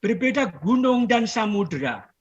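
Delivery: measured poem reading with long pauses; poetry recitation. Acted. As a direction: declaim verse, each line falling gently to rest. Recites second poem to audience.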